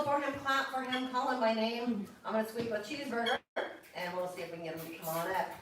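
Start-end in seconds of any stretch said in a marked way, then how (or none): none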